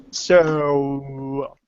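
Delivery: drawn-out, held "so"; imitated long pause. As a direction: mimic the host stretching "so" into a long pause. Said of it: "you make those long pauses".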